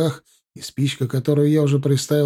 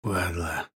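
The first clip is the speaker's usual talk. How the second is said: hoarse voice; 8 semitones lower, 9 dB quieter than usual. A narrator's reading. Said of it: "хрипловатый мужской голос".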